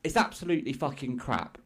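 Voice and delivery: ordinary talk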